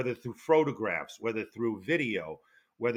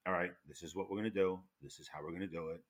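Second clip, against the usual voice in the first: softer, steady instructions; calm; 4 semitones lower, 6 dB quieter, faster than usual. Retold or imitated. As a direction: gently imitate a calm leader giving the plan. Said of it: "just that calmness".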